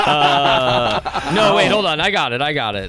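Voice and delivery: giggly, high and loud while laughing; stoner. Real, not acted. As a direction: laugh through it, stoned and giddy. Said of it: "I'm so high right now".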